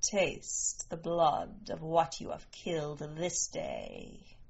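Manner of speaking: slow, low and soft, like a rite; hushed, deliberate, ceremonial; solemn maternal invitation. A narrator's reading. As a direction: offer it gently and solemnly. Mother's solemn rite with daughter.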